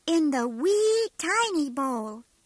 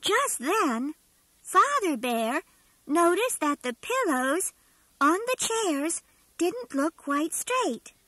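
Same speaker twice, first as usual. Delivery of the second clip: ordinary delivery, nothing marked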